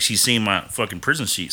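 ordinary delivery

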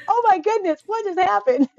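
put-on high, small frightened child voice; scared toddler voice. Mother voicing her scared toddler son. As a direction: voice the startled little boy.

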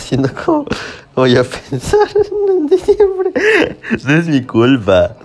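giggling through an embarrassed confession; laughing. Real, not acted. Confiesa algo y se ríe. (riéndose mientras confiesa a su pareja)

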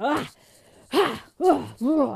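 short, loud bursts of "ah"; re-enacted shake-it-out exclamations; shaking-off grunts. Fan escorted out shaking off anger, loud mock grunts. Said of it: "I was, like, shaking off".

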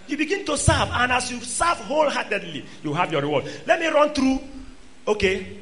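ordinary talk, nothing marked

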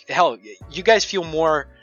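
ordinary talk

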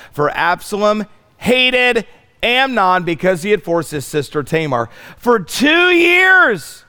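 high, loud, slow preaching climax; fiery preaching. Acted. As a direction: stretch out every word like a revival preacher. Preaching at full force.

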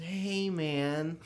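slow laid-back surfer-hippie greeting; mellow dreamy hippie. Retold or imitated. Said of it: "how Bill Burr always impersonates a hippie".

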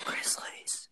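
soft unvoiced whisper. Real, not acted. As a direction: whisper the name. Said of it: "Why are we whispering".

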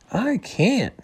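a small high-pitched wail of helplessness; mock panic; panicky. A narrator's reading. Bunny panicking, told to calm down.

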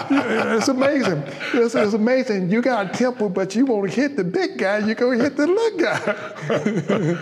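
high, loud amusement breaking into laughs; amused, laughing. Real, not acted. Man laughing over his mother's comeback about the big guy, amused.

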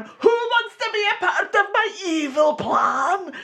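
high cartoon Scottish villain shout; over-the-top Scottish voice. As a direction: shout it at full tilt in bad Scottish. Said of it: "taking that voice down to".

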